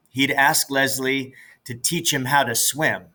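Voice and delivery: plain reading voice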